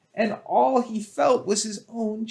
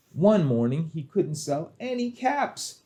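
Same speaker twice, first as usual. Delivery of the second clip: plain reading voice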